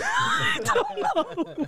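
slow, high, through laughter; laughing. Real, not acted. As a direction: squeeze it out laughing. Laughing hard.

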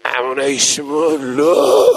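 slow, drawn-out mock lament; mock wailing. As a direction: swell into a hammed-up sobbing "no". Sinners wail at the sentence.

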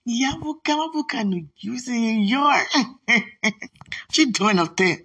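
high, cooing with delight; high excited cooing; gushing. Real, not acted. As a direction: coo her name affectionately. Gushing over photo.

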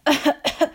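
loud repeated hacking coughs; high, sharp burst of coughs; coughing fit. A narrator's reading. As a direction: cough over and over from smoke. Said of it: "Walter couldn't stop coughing".

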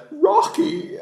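high, loud drag-style call; Frankenfurter. Acted. Showing friend the drag voice from Rocky Horror, high camp cry. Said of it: "like, the, the way".

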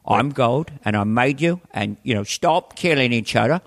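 put-on Cockney-accented film-star God; measured London-accented deity; Michael Caine. God coming down to scold mankind, Cockney impression. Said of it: "I do Michael Caine as God".

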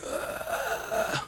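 voiceless rasping undead groan; long creaky zombie moan. Acted. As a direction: groan like the approaching dead.

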